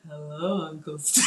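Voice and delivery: low, soft sleepy nighttime voice; a hint of a groggy bedtime voice; deep nighttime voice. Shown as a demonstration. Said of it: "you know how you have that deep nighttime voice".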